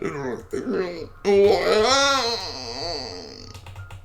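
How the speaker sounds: long wail rising, then sliding down; acted wail. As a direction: howl in hammed-up grief. Child witch wails for lost friend.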